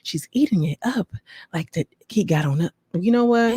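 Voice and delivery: normal talking voice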